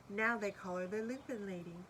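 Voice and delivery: ordinary delivery, nothing marked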